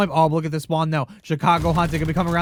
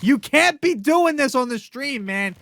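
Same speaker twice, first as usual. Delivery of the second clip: excited disbelief; 6 semitones higher, 3 dB louder, slower than usual. Real, not acted. Caster stunned by player's play.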